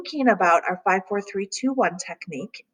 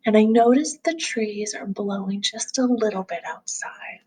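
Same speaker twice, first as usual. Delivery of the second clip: calm grounding exercise. Acted. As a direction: gently guide them through noticing.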